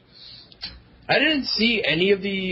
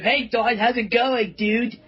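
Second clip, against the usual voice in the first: higher mock voice of the creator; put-on voice; 7 semitones higher than usual. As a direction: greet him in a silly higher put-on voice. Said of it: "He sounds like a Japanese guy".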